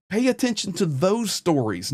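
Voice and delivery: usual speaking voice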